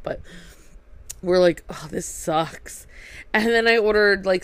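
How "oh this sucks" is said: slow, soft groan of disappointment; deflated. Retold or imitated. Diners to each other over sold-out lobster, deflated. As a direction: let it drag out quietly, dejected.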